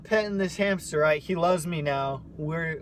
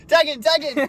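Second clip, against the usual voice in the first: calling out for the tag, a notch louder; mock yell; 8 semitones higher, 6 dB louder than usual. Retelling the sibling fight, a mock yell. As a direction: shout it like a wrestler begging for backup.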